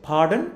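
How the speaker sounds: "Pardon" rising as a question; rising one-word question. Shown as a demonstration. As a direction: lift the end of "Pardon". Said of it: "দেখেন স্বরটা উঠে গেছে".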